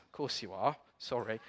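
sheepish aside. Real, not acted. Quiet aside after conceding.

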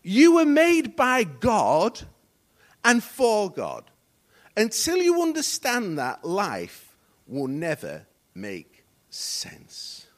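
very slow, loud declaration sinking to a hush; emphatic slow repeat. Real, not acted. Preacher repeating the quote so it sinks in, very slow and weighty. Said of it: "Let me say it again".

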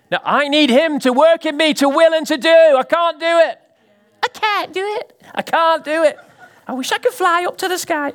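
high, loud pleading cry of a struggling believer; desperate plea. Retold or imitated. Preacher voicing a believer who can't cope, pleading high. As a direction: wail it like someone at the end of their rope.

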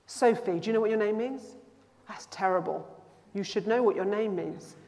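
normal talking voice

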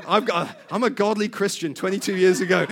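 normal talking voice

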